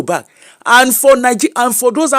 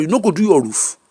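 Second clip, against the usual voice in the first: usual speaking voice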